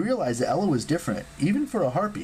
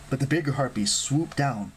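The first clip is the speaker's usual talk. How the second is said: plain reading voice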